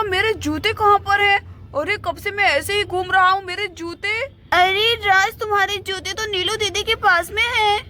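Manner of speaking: ordinary talk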